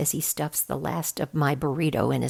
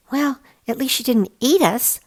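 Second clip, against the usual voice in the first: put-on raised boyish voice, louder; lightly raised kid voice for Max; Max's voice; 7 semitones higher, 5 dB louder than usual. A narrator's reading. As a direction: voice Max higher and brighter.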